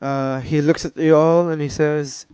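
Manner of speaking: ordinary talk, nothing marked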